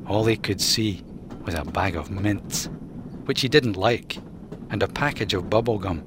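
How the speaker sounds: ordinary delivery, nothing marked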